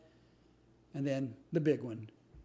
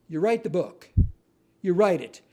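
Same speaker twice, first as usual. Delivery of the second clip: emphatic advice; 6 dB louder than usual. Acted.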